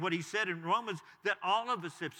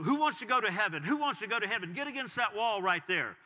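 raised-pitch preacher calling out to a room; rally the barroom like an imitated evangelist; 3 semitones higher, 5 dB louder than usual. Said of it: "the evangelist walks into the bar".

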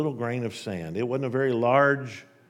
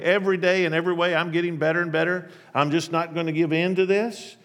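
high, fast, loud chirpy self-talk; mock upbeat affirmations; forced positivity; 6 semitones higher, 4 dB louder than usual. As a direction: pump yourself up with cheery denial. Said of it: "the warm atmosphere of wishful thinking".

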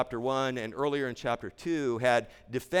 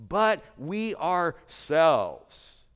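"But we ourselves" slow, each word weighted, higher and louder; emphatic contrast; 4 semitones higher, 4 dB louder, much slower than usual. Shown as a demonstration. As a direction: lean hard on "But we ourselves" as a stark contrast. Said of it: "Again, an emphatic, stark contrast".

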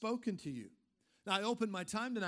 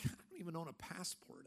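mock aside; 3 semitones lower, 11 dB quieter than usual. Self-mocking aside quote.

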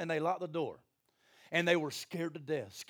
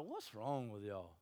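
softer, slow, calm and amused; unbothered Jesus; 7 semitones lower, 11 dB quieter, slower than usual. Retold or imitated. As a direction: lightly tease the panicking sailors. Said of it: "And he's like".

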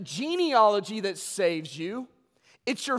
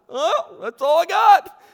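loud slow admission; 9 semitones higher, 12 dB louder, slower than usual. Retold or imitated. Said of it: "And I'm like".

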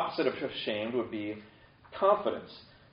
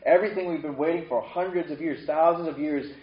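ordinary delivery

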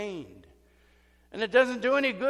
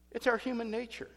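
ordinary talk, nothing marked

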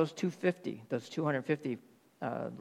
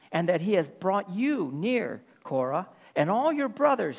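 raised, emphatic scripture reading; preaching scripture; 4 semitones higher than usual. Acted. Preacher reading Moses' rebuke of Korah, raised and emphatic. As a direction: proclaim Moses' rebuke with force.

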